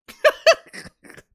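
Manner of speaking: real laugh breaking out, loud and high; high burst of laughter. Real, not acted. Vlogger laughing at his own jab at a commenter, loud high laugh.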